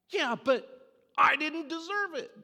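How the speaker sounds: high, loud, slow whine; indignant kid pleading his case; whiny kid. Retold or imitated. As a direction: drag it out like a sulky child. Kid protesting.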